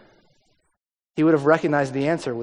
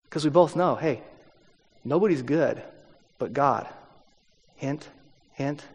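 soft, confiding, broken by pauses; knowing hint; 3 semitones lower, 7 dB quieter than usual. Shown as a demonstration. Preacher voices Jesus nudging the man, quiet and knowing. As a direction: drop to a quiet, leading aside, letting "hint, hint" fall away. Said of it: "I think this is how he says it".

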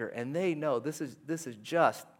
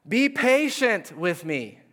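slow, raised pleading plea; begging; 6 semitones higher, 5 dB louder, slower than usual. Acted. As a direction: beg the king for more time. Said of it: "he begged".

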